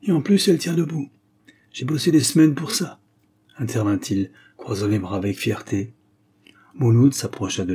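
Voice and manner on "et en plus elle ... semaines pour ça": slightly offended. A narrator's reading. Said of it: "l'air légèrement offensé".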